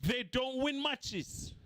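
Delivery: "win" stressed, slowly; slow emphatic. Real, not acted. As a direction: spell it out word by word. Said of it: "I repeat".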